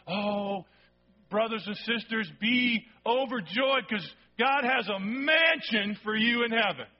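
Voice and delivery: hammed-up sing-song preacher, slow; mock preacher. Mocking a preacher promising heavenly mansions, sing-song. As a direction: lilt it like a syrupy preacher. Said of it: "you've all heard it".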